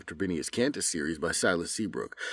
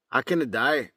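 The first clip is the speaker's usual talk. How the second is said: Scottish accent; 6 semitones higher, 4 dB louder than usual. Shown as a demonstration. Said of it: "Now as if I'm Scottish".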